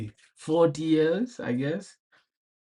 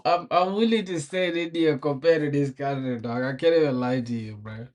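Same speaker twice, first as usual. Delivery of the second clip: ordinary talk, nothing marked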